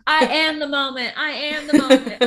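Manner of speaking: high, loud, proud diva line; mimicking a diva. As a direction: declare it like a star. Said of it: "Not to Mark".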